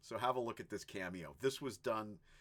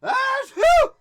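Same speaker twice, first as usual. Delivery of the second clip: huge, shouted mock sneeze, very high and loud; emphatic sneeze; 21 dB louder than usual. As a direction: belt out an enormous fake sneeze. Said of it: "like a really emphatic sneeze".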